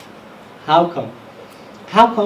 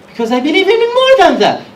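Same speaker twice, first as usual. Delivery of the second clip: high, loud cry, hitting more; proclaimed conviction; 10 semitones higher, 8 dB louder than usual. Retold or imitated. Preacher voicing Abu Bakr's proclaimed conviction. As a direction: proclaim his faith to the crowd.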